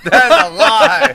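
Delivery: drawn-out, high outcry; slow, loud accusation through laughter; loud protest. Real, not acted. Host disputing co-host's claim, loud laughing protest. Mock argument over Thanksgiving food.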